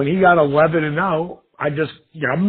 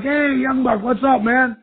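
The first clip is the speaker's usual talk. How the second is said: cheery greeting; 9 semitones higher than usual. Retold or imitated. Calling a friend, cheerful.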